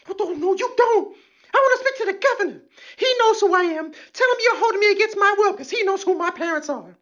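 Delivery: high, mocking arrestee voice; shrill arrestee. Comic mimics an arrestee demanding a lawyer, high mocking voice. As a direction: squeal for a lawyer like a spoiled detainee. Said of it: "captain has to show up".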